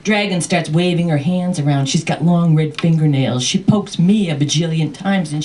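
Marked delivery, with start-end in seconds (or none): none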